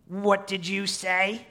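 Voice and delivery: slow, loud, high challenge; menacing creature. Acted. Preacher as Gollum challenging Smeagol's defiance, menacing. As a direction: demand it with drawn-out threat.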